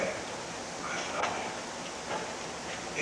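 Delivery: breathy, very slow, barely voiced "I love you"; soft loving tone. Shown as a demonstration. Coach demonstrates to the husband how to say it, whispered tenderly. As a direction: breathe the words out tenderly, drawn out. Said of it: "just speak to her in this tone".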